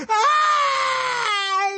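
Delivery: very high, long-held shriek; throat-cut scream. A narrator's reading. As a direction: scream as if your throat were being cut. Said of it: "as though she were having her throat cut".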